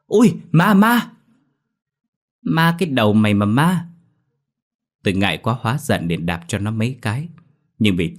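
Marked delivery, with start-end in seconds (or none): 0.1-1.1 high, loud shriek of fright; terrified scream. A narrator's reading. Brother sees his messy-haired sister and shrieks in fear. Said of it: "nó hét lên". 2.4-3.9 higher, louder annoyed snap; indignant retort. A narrator's reading. Embarrassed sister snaps back at her brother, annoyed.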